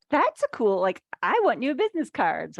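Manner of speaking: high, loud excited gush; eager impulse buyer. Retold or imitated. Voicing impulsive business spenders.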